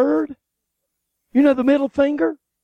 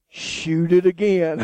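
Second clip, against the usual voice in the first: low slow imitation of Walt; 8 semitones lower, 3 dB quieter, slower than usual. Friend at the range urging another shot, slow and low. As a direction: lower and slower, a mock gruff Walt. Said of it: "Walt said".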